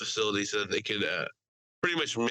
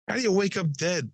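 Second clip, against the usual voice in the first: higher, lightly performed movie quote; quoted movie line; 7 semitones higher, 4 dB louder than usual. Retold or imitated. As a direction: toss out the film line with raised pitch. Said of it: "it's a Steve Buscemi, uh, line".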